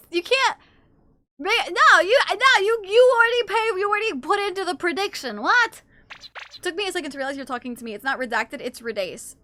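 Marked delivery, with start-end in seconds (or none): 0.1-5.8 high, loud, stammered objection; incredulous protest. Real, not acted. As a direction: object in disbelief, stumbling over words. Objects to cancelling the prediction.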